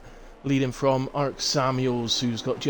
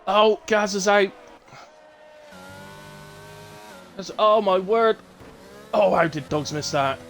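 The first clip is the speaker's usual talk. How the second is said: raised, louder live commentary; excited commentary; 8 semitones higher, 4 dB louder than usual. Real, not acted. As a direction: call out the crash excitedly. Crash seen live on track.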